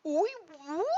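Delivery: a lightly put-on French accent fumbling the English; mock French accent. French comedian mangling the English sentence, mock French accent. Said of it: "I can't do, pretend the French, um, accent".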